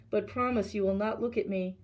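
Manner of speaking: usual speaking voice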